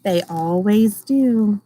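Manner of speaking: gentle storybook reading voice; reading to children. Acted. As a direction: read it like a picture book for a class. Teacher reads picture book aloud.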